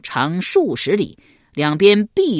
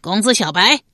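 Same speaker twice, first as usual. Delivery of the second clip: a taunting call, raised and louder; jeering shout; 3 semitones higher, 4 dB louder than usual. A narrator's reading. Rong soldier taunting the Qi camp, raised mocking shout. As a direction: call out to mock the enemy camp. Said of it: "其一人喊道".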